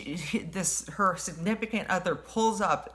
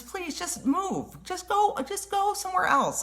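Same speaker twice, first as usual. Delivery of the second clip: exasperated; 13 semitones higher than usual. Retold or imitated. Retold confrontation rising.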